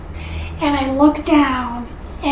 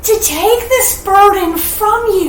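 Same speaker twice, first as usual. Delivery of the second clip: high and tearful, voice breaking; 6 semitones higher than usual. Real, not acted. Wife vowing to her husband, tearful. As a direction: push through the tears to say it.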